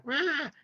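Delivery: high, small squeak of a roar; little cub roar. Retold or imitated. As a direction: try to roar like a tiny lion cub. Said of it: "he does a little like".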